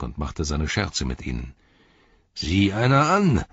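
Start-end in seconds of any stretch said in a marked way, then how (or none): 2.3-3.4 high, drawn-out mocking exclamation; gleeful bully's taunt, swooping up; whinnying jeer. A narrator's reading. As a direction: crow slowly at the victims. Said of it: "wieherte er".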